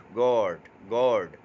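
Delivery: गॉड stretched slowly with only a faint R; soft R. Shown as a demonstration. A teacher slowly demonstrating the light R sound in गॉड. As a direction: draw out गॉड, barely touching the R. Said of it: "आर की आवाज़ हल्की सी निकालेंगे".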